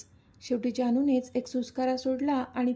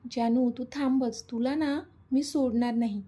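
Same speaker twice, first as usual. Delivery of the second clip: usual speaking voice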